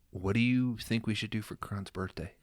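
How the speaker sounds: soft, careful question. Acted. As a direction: keep it softly hushed. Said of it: "I will talk lower".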